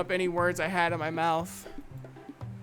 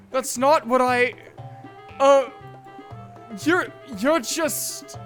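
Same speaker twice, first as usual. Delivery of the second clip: loud, halting, stammered denial; flustered protest; 2 semitones higher, 5 dB louder, slower than usual. Acted. Yuri denying she tries to impress, flustered. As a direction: stumble over the words, rattled.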